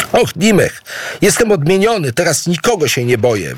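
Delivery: joyful exclamation. A narrator's reading. Idu cries out to his wife, delighted by the mask. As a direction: exclaim it, thrilled and freed from fear. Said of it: "wykrzyknął radośnie".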